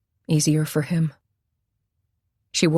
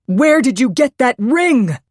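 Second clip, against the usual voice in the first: shout; 8 semitones higher, 6 dB louder than usual. A narrator's reading. Repeated shouted demand.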